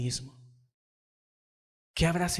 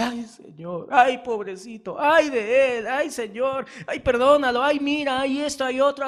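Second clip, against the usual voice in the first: higher, louder, hammed-up moaning with repeated ay; mock wailing lament; 8 semitones higher, 7 dB louder than usual. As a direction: ham up a weepy old prophet's lament. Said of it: "Samuel en la tierra".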